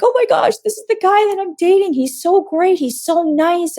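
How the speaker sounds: high, bubbly gushing about the new guy; giddy gushing. Retold or imitated. Telling her roommate about her date, high giddy gushing. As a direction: squeal it out like a smitten girl.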